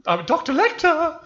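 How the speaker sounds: very high, loud, slow squeaky villain; bad Hannibal Lecter. Introducing himself as Lecter, high squeaky voice. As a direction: screech a bad Lecter impression. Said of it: "I can't do Hannibal Lecter".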